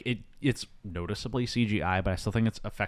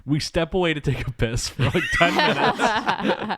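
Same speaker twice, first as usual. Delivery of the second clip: higher, louder comic scolding breaking into laughter; mock outrage; 6 semitones higher, 4 dB louder, slower than usual. Host voicing the Magi scolding the explorers, mock-indignant. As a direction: berate them in hammed-up exasperation, laughing.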